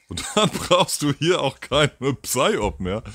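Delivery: chuckling at the absurd idea; laughing. Real, not acted. Amused aside about the psyop. (lachend, etwas langsamer und lauter)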